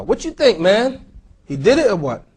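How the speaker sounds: usual speaking voice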